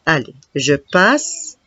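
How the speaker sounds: ordinary delivery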